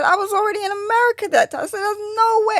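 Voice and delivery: lightly acted, high-pitched objection; retold incredulous protest. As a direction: protest high and quick, as if retelling it to a friend.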